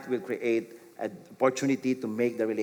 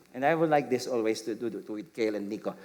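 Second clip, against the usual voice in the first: normal talking voice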